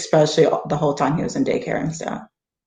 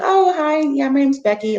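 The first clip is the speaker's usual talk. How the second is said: mock breezy teacher; 10 semitones higher than usual. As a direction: toss off the first name like to a buddy, pitched high. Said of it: "they'd be like".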